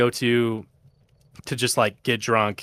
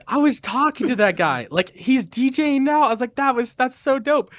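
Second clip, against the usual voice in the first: much higher hyped voice; retold excitement; 13 semitones higher, 3 dB louder than usual. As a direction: gush like a starstruck fan. Retelling own excitement.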